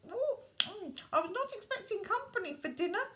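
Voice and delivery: put-on high, fast old-lady voice through a mouthful; surprised neighbour lady chewing; mumbling with mouthful. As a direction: talk round a mouthful of meatballs. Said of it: "she mumbled with a mouthful of meatballs".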